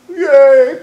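loud husband's jesting call; merry husband. Acted. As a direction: boom it out as a tease. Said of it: "quoth my husband".

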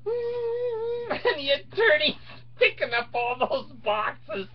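high, slow fake whimpering; mock crying. Mocking the attorneys hauling boxes out, fake crying. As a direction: sob along as if it's tragic.

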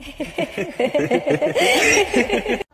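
long rattling cackle; creepy laugh. Acted. Apparition taunting the girl about Mom, creepy long laugh. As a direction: cackle on and on eerily.

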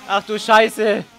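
sudden high alarmed yelps; startled gamer panic; panicked cries. Real, not acted. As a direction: yelp in real panic at the screen. Player panicking in game.

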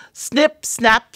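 ordinary delivery, nothing marked

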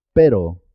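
pero with a tapped R; simple R. Shown as a demonstration. Teacher closes the perro contrast, pero with simple R. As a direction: tap the R in pero once, falling. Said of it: "suena simple".

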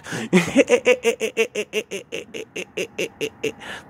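high, eerie cackle of the woman; imitated creepy high-pitched laugh. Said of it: "she had this fucking creepy-ass laugh".

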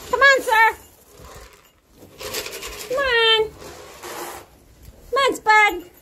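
high sing-song coaxing; high coaxing call. Real, not acted. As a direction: coax them in, high and bright. Coaxing goats with grain.

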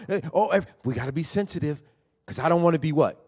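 ordinary talk

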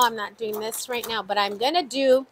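ordinary talk

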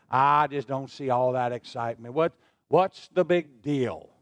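low, slow, unimpressed grumble; mock the doubter, dragging it out; grumbling skeptic. A preacher mocking church members who scorn joy, low and slow. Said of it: "that'll rub some people's fur the wrong way".

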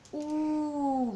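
exaggerated rounded "U", lips pushed forward; drawn-out U. Shown as a demonstration. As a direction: show the mouth shape on "U". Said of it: "Mire mi boquita". ("U" exagerada con labios redondeados)

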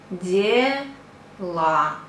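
дела read slowly as two separate syllables, de... la. Shown as a demonstration. Teacher reading how-are-you for beginners, syllable by syllable. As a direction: sound out дела in slow pieces. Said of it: "We read".

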